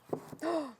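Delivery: short excited intake of breath; quick, pleased gasp; delighted gasp. Real, not acted. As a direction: gasp at a happy discovery. Spotting a Halloween book.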